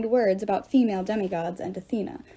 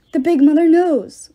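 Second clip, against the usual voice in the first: fearful cry; 6 semitones higher, 9 dB louder than usual. A narrator's reading. Ghost cries out in fear.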